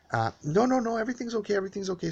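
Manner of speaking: quickly smooth it over; imitating the neighbor's sudden retreat; backing down.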